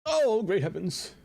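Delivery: slow, high mock exclamation; dramatic voice. Repeat of exclamation.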